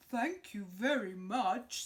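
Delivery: loud, slow bear. Acted. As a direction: thank them grandly. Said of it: "said the bear".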